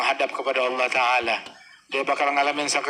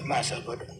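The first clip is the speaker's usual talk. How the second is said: lower, much softer throwaway; muttered shrug; 4 semitones lower, 7 dB quieter than usual. Retold or imitated. Repeating the shrug at gossip, quiet mutter. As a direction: let it drop under your breath.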